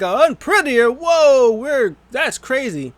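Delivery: high, loud, amazed outburst; stunned commentator. Retold or imitated. Retelling the wrestling commentator's reaction to the Unprettier, stunned commentator. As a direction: blurt it out in mock shock, stretching the end. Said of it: "Corey Graves was like".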